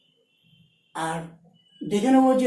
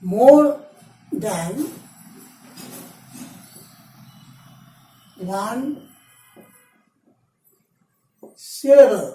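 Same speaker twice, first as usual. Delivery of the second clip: MORE... THAN... ONE... SYLLABLE, long pauses between words; word-by-word dictation; 3 dB louder, much slower than usual. Shown as a demonstration. Teacher dictates rule while writing it. (একটা একটা শব্দ থেমে থেমে বলা)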